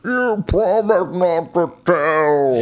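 slow, high monster wail; gargoyle voice. Acted. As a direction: draw the words out in a gargoyle howl. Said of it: "she looks like a fucking gargoyle".

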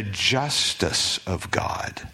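slow hushed whisper of awe. Acted. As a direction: breathe the words slowly, almost silent. Dramatic aside in sermon.

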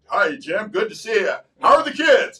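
lightly voiced cheery suburban dad greeting a neighbor. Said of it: "I can talk like".

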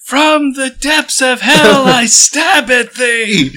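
high, slow, booming archaic battle cry; theatrical villain shout. Acted. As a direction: bellow it like a demon from hell. Dramatic enemy attack declaration.

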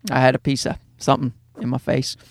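ordinary delivery

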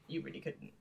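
quiet, dry put-down; deadpan mutter. Retold or imitated. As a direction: drop it low and dry. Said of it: "and Mark's like".

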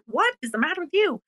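high, fast mock disbelief; mock baffled onlooker. Host mimicking people baffled by her word love, high quick mock. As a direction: toss off a quick baffled look in voice. Mocking how people react.

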